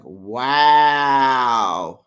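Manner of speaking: loud, drawn-out shocked wow; stunned wow. Real, not acted. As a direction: let the shock burst out. Shocked reaction to Gina's words.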